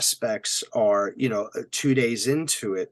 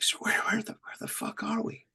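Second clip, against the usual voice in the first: whispering; 11 semitones higher, 5 dB quieter than usual. Retold or imitated. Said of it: "He's like".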